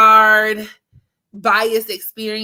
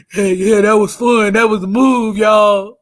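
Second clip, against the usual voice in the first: tough friend voice; 6 dB louder than usual. Retold or imitated. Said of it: "go back to sounding like".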